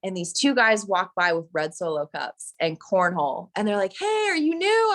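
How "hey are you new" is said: high, bright party-guy greeting; chirpy hey. Retold or imitated. Party neighbours calling over to the new girl, high and cheery. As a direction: call it out like a friendly neighbour.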